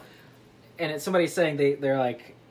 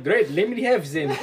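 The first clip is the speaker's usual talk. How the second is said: badly faked French accent; clumsy, wandering French accent; Poirot. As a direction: attempt a French detective accent. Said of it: "And he's like".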